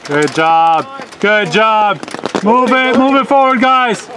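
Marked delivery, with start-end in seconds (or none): 1.2-4.1 loud, high shouted instructions; yelling from the sidelines. Real, not acted. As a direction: push the team forward from the touchline. Parent urging team from sideline.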